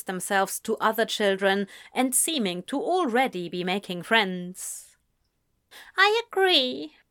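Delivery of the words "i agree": high-pitched, mild lament; a touch of wistful regret. A narrator's reading. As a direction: agree mournfully, pitched up. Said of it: "Ezra lamented".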